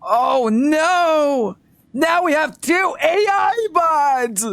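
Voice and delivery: very high, drawn-out panicked cry; hammed-up high-pitched alarm; crazy conspiracy nut. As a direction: wail it like a paranoid crackpot. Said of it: "Tinfoil Head user ninety-five comes in and says".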